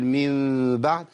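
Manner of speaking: من بعد held slowly, lips just touching; touch the lips lightly through من بعد; lips barely touching. Shown as a demonstration. Teacher to students, light lip-touch demo. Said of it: "تماس للشفتين".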